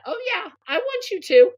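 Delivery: very high, loud, thrilled yes; eager yes. Retold or imitated. Answering the farmer's straw hat offer, thrilled squeal. Self-quote, +16 semitones, far.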